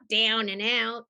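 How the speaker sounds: "down and out" with broad Australian vowels; Australian accent. Shown as a demonstration. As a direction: say "down and out" the Aussie way. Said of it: "beat out the Australian".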